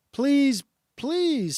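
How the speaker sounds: a tiny lamb daring to beg; high lamb voice. A narrator's reading. The young lamb begging the ape to listen, high and pleading. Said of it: "said the high voice of a woolly lamb".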